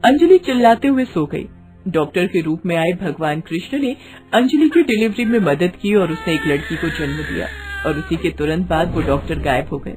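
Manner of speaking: usual speaking voice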